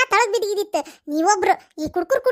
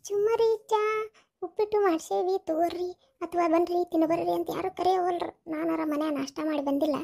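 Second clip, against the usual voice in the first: hungry muttering; 2 semitones lower, 8 dB quieter than usual. Acted. Hungry mourner waiting for tea and snacks, muttering softly. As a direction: grumble under your breath about an empty stomach.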